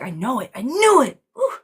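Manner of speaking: building to a high, loud "I knew it"; rising to a triumphant squeal; from mild to a high, loud exclamation; excited i-knew-it. Retold or imitated. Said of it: "I still get excited".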